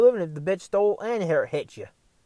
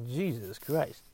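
nineteen-fifties movie delivery; 3 semitones lower, 10 dB quieter, much slower than usual. Real, not acted. Man looking at a bad parking lot, fifties movie delivery. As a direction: say it like a line from a fifties film. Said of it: "I felt like I was in a nineteen fifties movie".

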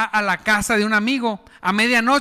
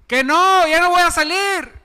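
high, louder fed-up yell; shouted refusal; 8 semitones higher, 4 dB louder than usual. Acted. Annoyed man yelling from bed to the neighbor outside.

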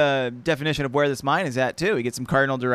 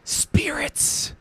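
high, whispered exclamation, then a gasp; hammed-up spooked gasp; 9 semitones higher than usual. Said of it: "Joe gasped".

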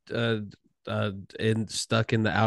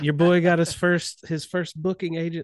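pitched-up playful boast; playful bragging; 7 semitones higher than usual. Pretend. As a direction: show off a little, mock-proud. Playfully bragging about first agent.

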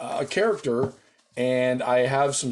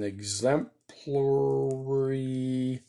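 EXEMPLARY stretched out very slowly; EXEMPLARY with long, held syllables; drawn out; 3 dB quieter, much slower than usual. Real, not acted. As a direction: drag out EXEMPLARY while writing it down. Drawing out the word while writing it.

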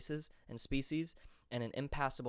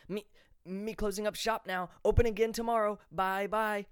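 much higher and louder, flustered stammering peddler; panicky merchant; 9 semitones higher, 6 dB louder than usual. A narrator's reading. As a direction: blurt it out while fleeing. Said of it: "he said in hiding".

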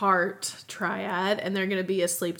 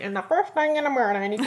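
lightly done vintage radio voice; a hint of a 1950s ad man; old timey voice; 4 semitones higher, 4 dB louder, faster than usual. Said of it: "old timey voice".

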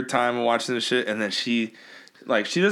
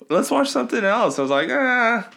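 high mock voice; 11 semitones higher, 4 dB louder than usual. Quoted argument over movie choice.

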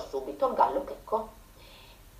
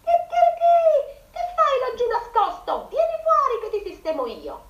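high, loud crow into the well; rooster shouting; 5 dB louder than usual. A narrator's reading. Rooster shouting down the well at his reflection, loud and high. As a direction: yell at full lung as a cocky rooster. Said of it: "gridò con quanto fiato aveva in gola".

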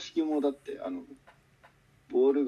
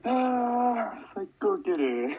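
laughing fit; 9 semitones higher, 3 dB louder, much slower than usual. Real, not acted. Cracking up with laughter.

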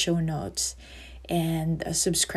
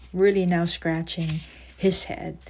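ordinary delivery

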